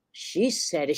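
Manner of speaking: normal talking voice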